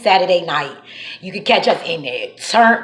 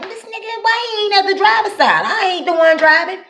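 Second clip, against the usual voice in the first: incredulous; 11 semitones higher than usual. Retold or imitated. Annoyed at officer, acted thought.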